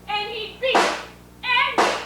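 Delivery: building outcry. Acted. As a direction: climb to a hammed-up wail. Wife dramatizes husband's beatings.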